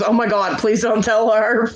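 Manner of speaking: high, drawn-out mock plea; retold pleading. Aunt begs child to keep secret.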